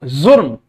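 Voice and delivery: जुर्म said with a plain j and no nukta, marked as wrong; wrong pronunciation. Shown as a demonstration. Teacher showing the mistake, जुर्म without nukta. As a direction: show जुर्म done wrong. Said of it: "गलत".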